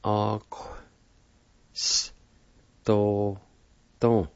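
"Ox-, s-, to-, ton" in separate sounds; "Ox-, s-, to-, ton" broken up for learners; syllable-by-syllable demo. Shown as a demonstration. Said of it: "pronounce the Q sound with the first A like this".

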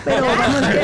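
put-on Spain Spanish accent, breaking into a laugh; mock a Colombian who now talks like a Spaniard, laughing; fake Spanish accent. A Colombian client from Barcelona mocked, in a put-on Spain Spanish accent. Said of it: "empiezan a hablar con acento español".

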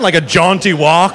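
usual speaking voice

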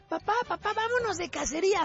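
put-on very high little-kid voice; niño. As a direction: pipe up as a child pleading with dad. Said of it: "está un niño que le dice a su papá". (voz aguda de niño, actuada)

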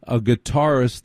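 ordinary delivery

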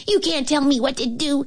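high, petulant royal protest; shouting king. A narrator's reading. King to the scolding cook, high mock shout. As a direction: pitch it up like a spoilt king defying orders. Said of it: "he shouted".

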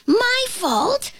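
high, squeaky offended question; indignant flea. Acted. Flea answering the ant's blame, indignant. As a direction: squeak it in disbelief.

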